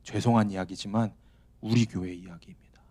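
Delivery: hushed apology to the congregation; whispered confession. Real, not acted. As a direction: admit it in a whisper. Confession about own church.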